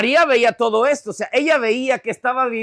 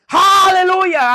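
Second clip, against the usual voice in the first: loud, drawn-out hallelujah cry; sudden high shout of praise; imitated congregant's shout; 8 semitones higher, 8 dB louder, much slower than usual. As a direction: burst out like an excited churchgoer. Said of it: "Alguien grita".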